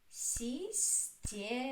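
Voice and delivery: "Система" slowly, in three separate syllables. Shown as a demonstration. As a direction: stretch out си, сте, ма in "Система". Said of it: "Which one is stressed?".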